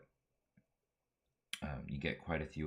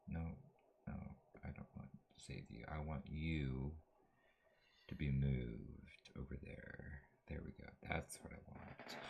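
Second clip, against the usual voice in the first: mutter; 9 dB quieter than usual. Real, not acted. Muttering to herself while editing.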